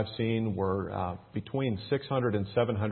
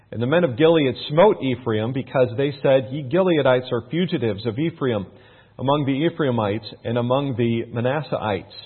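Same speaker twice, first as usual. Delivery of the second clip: raised, louder Bible-reading voice; scripture reading; 3 semitones higher, 7 dB louder than usual. Acted. Pastor reading the Bible to his church, raised and declaiming. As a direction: read it out like a preacher to the congregation.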